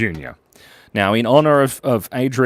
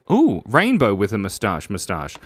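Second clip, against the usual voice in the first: normal talking voice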